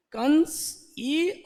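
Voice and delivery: कंस ई very slowly, letter by letter; slow spelling out. Shown as a demonstration. Teacher slowly dictating letters to the class. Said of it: "spelling the word for class".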